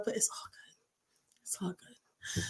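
whispered under the breath, slow at the end; sarcastic whisper. Real, not acted. Brushing off Facebook jail to listeners, in a whisper. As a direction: breathe it out as a bitter aside. Sarcastic aside after a complaint.

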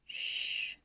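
gentle hushing breath; calm him with a hush; soft shush. A narrator's reading. Bashir quiets the dying man, hushing softly. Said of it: "Bashir told him".

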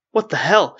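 shout. A narrator's reading. Arwen as the earth shakes, shouting. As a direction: shout it in alarm. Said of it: "shouted Arwen as she struggled".

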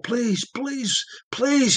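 high, desperate pleading; a man under torture begging his captor to stop. Acted. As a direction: beg for mercy in pain. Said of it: "he begged".

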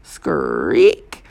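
low imitated creak of a swing; drawn-out metallic screak; rusty creak. A narrator's reading. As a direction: creak the word like a rusty chain. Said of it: "like a swing on a rusty chain".

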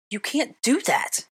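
high, slow indignant objection; objecting. A narrator's reading. Hermione protesting to Draco over the chess move. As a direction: draw out the protest in disbelief. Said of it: "I objected".

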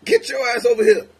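high, loud mock yell; mad yell. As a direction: bark it like a raging husband. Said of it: "When he mad".

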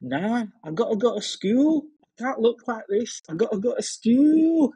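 high-pitched, hammed-up Northern accent. Imitating a girl with drawn-on moustache before school, Northern accent. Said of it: "I'm probably gonna butcher the accent".

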